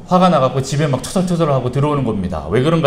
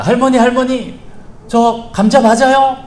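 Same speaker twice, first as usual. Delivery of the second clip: put-on pleading child; 12 semitones higher, 6 dB louder, slower than usual. Son pleads with grandma.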